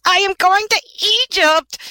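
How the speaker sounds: very high, joyful little bird voice; excited cry. A narrator's reading. Swallow announcing his trip to all, excited cry. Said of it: "cried the swallow".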